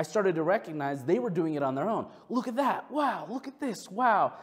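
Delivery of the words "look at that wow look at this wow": high-pitched, wowed pointing-out; imitated childlike amazement. Kids pointing things out at Disneyland, imitated amazement. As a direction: play a kid marvelling at everything.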